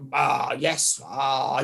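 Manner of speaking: low, vague mock hedging; non-committal. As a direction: imitate someone avoiding any commitment. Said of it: "a non-committal response".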